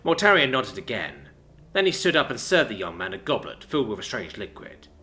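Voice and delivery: ordinary delivery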